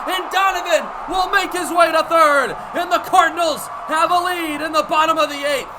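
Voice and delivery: loud, high play-by-play call; excited home run call. Acted. Radio announcer calling the go-ahead hit. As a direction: ride the play up in a shout.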